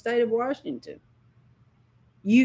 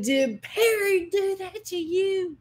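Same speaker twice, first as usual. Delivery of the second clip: high, drawn-out outraged demand; anguished rage; 11 semitones higher than usual. Retold or imitated. Mother questions her hurt son in the dream, anguished rage. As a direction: voice climbing high with a mother's fury.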